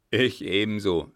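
loud, forceful seconding; captain firmly backing the baron; emphatic agreement. A narrator's reading. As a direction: chime in loudly behind the threat. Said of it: "stimmte der Letztere bei".

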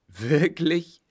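loud, high-pitched taunt; amused, rising disbelief; mocking amusement. A narrator's reading. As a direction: toss it out in loud mockery. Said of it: "lachte er".

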